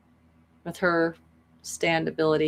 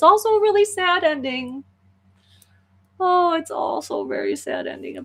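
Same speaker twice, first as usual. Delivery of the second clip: very high, whiny wail; plaintive lament; 10 semitones higher than usual. Real, not acted. Storyteller upset by the bittersweet ending, high plaintive wail. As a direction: moan it in a high, stretched-out voice.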